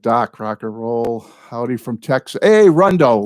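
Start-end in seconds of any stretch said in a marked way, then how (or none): 2.4-3.2 bright, loud hello to a friend; sudden high, loud shout-out; excited greeting. Real, not acted. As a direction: call out his name with delight. Calling out to viewer in chat.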